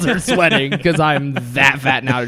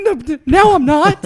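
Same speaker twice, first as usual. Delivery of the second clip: much higher, louder, voice cracking into a wail; mock crying squeak; 9 dB louder than usual. Telling friends how he broke down at the movie, squeaky mock sob. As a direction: play a grown man breaking down into tears. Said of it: "And I was like".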